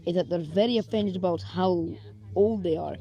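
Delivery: ordinary delivery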